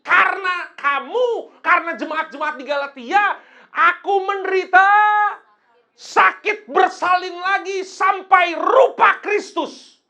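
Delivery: loud, high, drawn-out scripture proclamation; ringing pulpit shout; forceful preaching. Real, not acted. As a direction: preach it at full force, stretching key words. Emphatic scripture quoting at full force.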